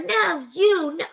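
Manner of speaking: very high squabbling outburst; high mimicked protest; mock bickering. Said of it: "it was pandemonium".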